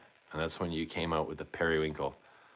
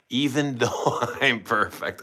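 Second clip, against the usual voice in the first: laughing read; 4 semitones higher, 4 dB louder than usual. Real, not acted. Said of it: "I love that".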